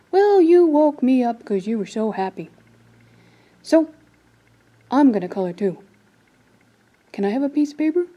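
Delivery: loud bear voice; grumpy bear puppet. Acted. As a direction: boom it out like a woken bear.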